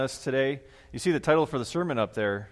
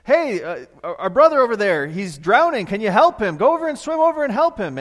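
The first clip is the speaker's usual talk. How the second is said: loud, high urgent shout; yelling; 9 semitones higher, 9 dB louder than usual. Retold or imitated. Watchman yells to the swimmer to save drowning brother, urgent yell. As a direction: call across the river for help. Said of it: "he yells out to the".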